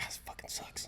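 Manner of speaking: hushed, breathy whisper under the breath; whispered curse. Retold or imitated. To himself in math class, a hushed whispered curse. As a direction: whisper so the teacher won't hear. Cursing quietly during a math test.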